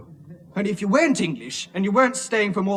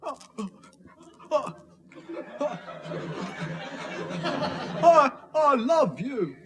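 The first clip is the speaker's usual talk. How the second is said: halting, stammering, higher-pitched confession; awkward stammer with long hesitations; reluctant, embarrassed; 7 semitones higher, slower than usual. Acted. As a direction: struggle to get the words out, slowly. Reluctant husband forced to declare love.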